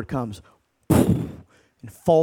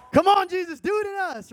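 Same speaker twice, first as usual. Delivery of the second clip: fervent plea; 14 semitones higher than usual. Real, not acted. Excited plea to Jesus.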